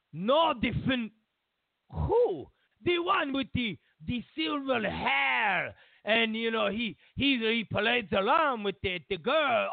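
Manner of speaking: put-on high, impatient comic Russian accent; flustered explaining. Putin impatiently describes the man.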